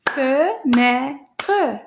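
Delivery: each syllable of "Fenêtre" slow and separate. Shown as a demonstration. Teacher clapping syllables for the children, "Fenêtre" split into slow beats. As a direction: clap out "Fenêtre" in distinct beats. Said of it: "clap the syll- syllables for this word".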